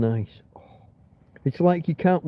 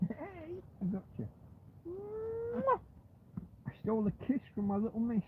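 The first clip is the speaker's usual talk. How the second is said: hushed pet talk, much higher and much softer; cooing to the dog; 6 semitones higher, 14 dB quieter than usual. Real, not acted. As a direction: coo to her like a little darling.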